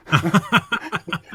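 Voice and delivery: bursting out in a real laugh. Real, not acted. Laughing at his own Stasi joke, real laugh.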